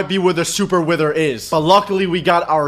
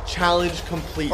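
soft, slow wonder; stunned relief; 7 dB quieter, slower than usual. Real, not acted. Gamer finishing the challenge, stunned relief. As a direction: let it out quietly and slowly after the win. Awed after winning the challenge.

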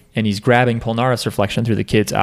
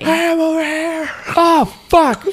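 mock distant yell. Victim yelling from town.

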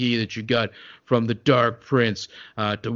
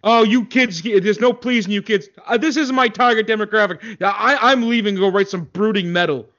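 much higher, louder exasperated whine; 9 semitones higher, 3 dB louder than usual. Acted. Storyteller quitting on the kids, high exasperated whine. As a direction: throw up your hands at the kids.

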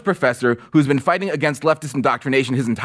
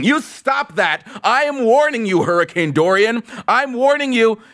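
hammed-up telling-off, pitched up; mock screaming; 6 semitones higher than usual. Host pretending to threaten Hurricane Dorian, mock screaming. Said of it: "screaming at the hurricane".

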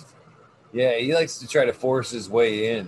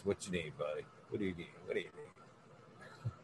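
soft, dropping to a whisper; fading to a whisper; 7 semitones lower, 19 dB quieter than usual. Retold or imitated. Asking the old neighbor what he needs, fading to a whisper. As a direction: let it fade down to a whisper. Quoted to visitor, dropping away.

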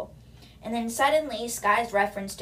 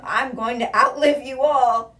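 put-on slow, higher, louder proud old woman's voice; defiant old lady; 4 semitones higher, 8 dB louder than usual. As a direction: gloat like a stubborn grandma who'll bury them all. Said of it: "she's a confident woman".

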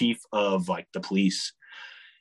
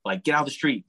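retold yelling; 6 semitones higher than usual. Guards telling him to get out of the street, mock yelling. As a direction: imitate guards shooing someone off, rapid-fire noes. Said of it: "yelling at me".

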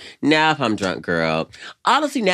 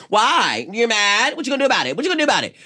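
high, mocking challenge; mock taunting; 12 semitones higher, faster than usual. Imitating the boy taunting her while she was drunk. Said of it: "He goes".

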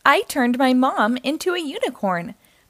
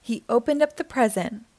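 plain reading voice

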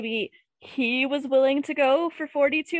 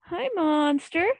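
talking to a pet; 5 semitones higher than usual. Real, not acted. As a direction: coo a hello to the cat. Greeting her cat.

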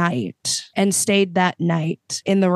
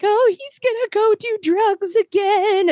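distraught mom; 12 semitones higher than usual. Retold or imitated. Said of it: "the mom is beside herself".

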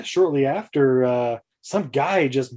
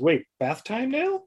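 mock surprise; 7 semitones higher than usual. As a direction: feign disbelief. Speaker mocks own reaction.

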